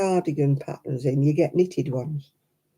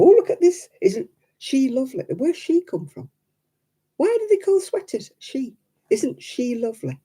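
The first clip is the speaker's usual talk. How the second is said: high, gushing mock praise; mock the gushing video girls, much higher; 7 semitones higher than usual. Girls on videos show off sweaters, high gushing mock voice. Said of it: "they go".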